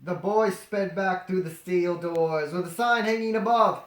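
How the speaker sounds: plain reading voice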